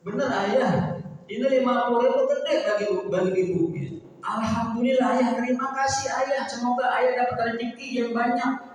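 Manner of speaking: a pious wife thrilled with a small gift; grateful wife. Acted. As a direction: gush thanks to her husband. Good wife voiced thanking husband.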